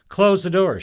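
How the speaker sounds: a loud imperious command; commanding order. Acted. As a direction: bark it like an order from the dark. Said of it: "she ordered".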